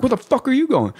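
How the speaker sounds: high barking yell; drill instructor yelling. Retold or imitated. Drill instructor catching a recruit running, high bark. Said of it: "the drill instructor was like".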